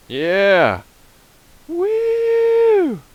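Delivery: very high, loud, gleeful shouting; giddy high cheering; gleeful whooping. Real, not acted. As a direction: whoop like on a thrill ride. Celebrating the flight.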